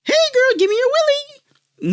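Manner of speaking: very high mock alien squeal; alien abductors. Aliens accosting the girl they abduct, hammed-up squeaky voice. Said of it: "aliens who abduct Momo who are like".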